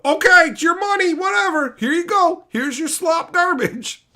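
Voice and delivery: high, loud grudging hand-over; sarcastic fed-up writer. Retold or imitated. Hired writer resignedly hands the client his slop, high and loud. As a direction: shrug it off with contempt.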